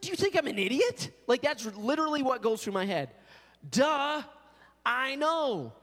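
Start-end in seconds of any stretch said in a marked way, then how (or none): 0.0-1.1 exasperated inner voice. Retold or imitated. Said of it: "I'm like".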